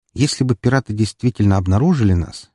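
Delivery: ordinary delivery, nothing marked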